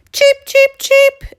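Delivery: very high, loud joyful peeps; exclaimed cheeps. Acted. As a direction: burst out cheeping. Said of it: "exclaimed the little chicks".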